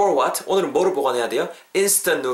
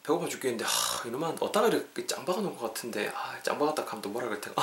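grumbling to himself; 9 dB quieter than usual. Acted. Hungry man searching for hidden noodles, grumbling quietly to himself. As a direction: grumble softly under your breath.

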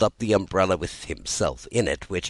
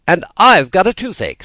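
complaining; 12 semitones higher, 11 dB louder than usual. A narrator's reading. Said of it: "said Tweedledee".

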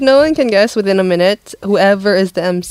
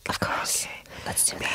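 barely voiced whisper; 18 dB quieter than usual. Real, not acted. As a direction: answer in a whisper. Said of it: "Are we whispering still?".

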